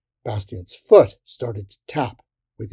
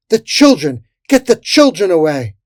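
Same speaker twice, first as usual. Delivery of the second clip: high, loud cry of alarm; urgent shout; 6 semitones higher, 9 dB louder than usual. A narrator's reading. Freddy Bulrush calling to get children away, urgent shout. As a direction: yell to rescue the children. Fairy shouts orders mid-battle.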